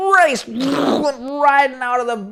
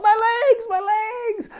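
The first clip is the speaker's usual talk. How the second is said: high pained cry, much higher; 12 semitones higher, slower than usual. Acted. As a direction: wail about his broken legs. Injured boy pulled from pipe.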